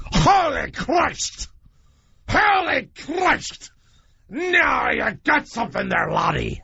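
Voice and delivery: put-on high, slow, shouted old-timer exclaiming; imitating the phone guy. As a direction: bellow it out slowly like an amazed old salesman on the phone. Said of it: "That's how he speaks".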